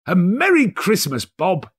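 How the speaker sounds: high, hearty Merry Christmas; mean every word; earnest greeting. A narrator's reading. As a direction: Scrooge sincerely greeting his clerk. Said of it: "with an earnestness that could not be mistaken".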